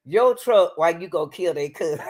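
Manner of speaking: ordinary talk, nothing marked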